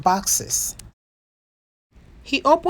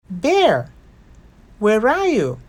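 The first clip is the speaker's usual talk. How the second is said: raised, higher call for Bear; 3 semitones higher, 3 dB louder than usual. A narrator's reading. Nora searching the house, calling out for Bear. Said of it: "Nora called".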